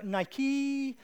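"Nike" with a long, high final ee; "Nike" said as Nikeee, loud; drawn-out double E. Shown as a demonstration. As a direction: stretch the end of "Nike" into a high eee. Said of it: "had two E's on the end".

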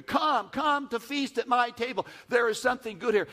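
high, loud, half-sung invitation; beckoning call. Acted. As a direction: sing out the invitation to the feast. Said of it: "You continue to come".

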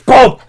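loud imitated bang. Imitating a gunshot going off.

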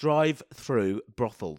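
slowed, each syllable placed on drive-through; slow careful saying. Shown as a demonstration. Repeating the phrase to her cohost to test it, slowly. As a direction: pronounce Drive-through brothels deliberately. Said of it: "That's quite hard to say".

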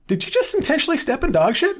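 high, loud incredulous cartoon voice; imitating Mac. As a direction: squeal it in mock disbelief. Mac voiced in retold episode.

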